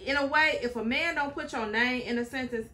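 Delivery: ordinary talk, nothing marked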